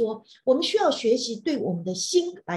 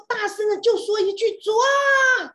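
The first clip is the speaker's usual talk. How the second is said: a loud, high cry of the word; shouted prayer; 9 semitones higher, 6 dB louder than usual. Shown as a demonstration. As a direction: cry out to the Lord at full voice.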